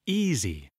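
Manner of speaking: "Easy" with a strong first syllable, falling away; first-syllable stress. Shown as a demonstration. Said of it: "Accent sur la première syllabe".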